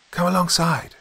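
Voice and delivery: high, hearty call across the water; exclaiming. A narrator's reading. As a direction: call out an invitation to the boat. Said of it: "exclaimed Will".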